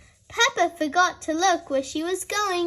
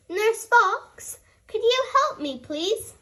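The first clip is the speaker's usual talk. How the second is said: helpless plea; 8 semitones higher than usual. A narrator's reading. Bear stuck on snack box.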